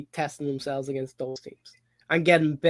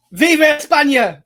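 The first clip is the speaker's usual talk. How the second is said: shouted Spanish cheer; 10 semitones higher, 9 dB louder than usual. Acted. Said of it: "that didn't sound quite right in an Irish accent".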